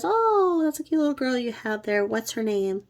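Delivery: fake-nice gushing over the cute girl; mock sweet neighbor. Babysitter sweet-talking single dad about his daughter, mock sugary.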